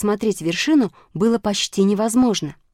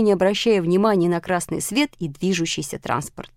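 usual speaking voice

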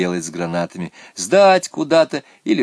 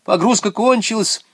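high, loud cry; urgent high-pitched yell; girl's shout; 13 semitones higher, 6 dB louder than usual. A narrator's reading. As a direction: call a halt excitedly. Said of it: "закричала Наташа".

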